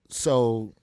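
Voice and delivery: normal talking voice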